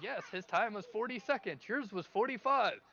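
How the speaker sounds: high-pitched contest official; insistent announcer. Acted. Announcer corrects the ogre.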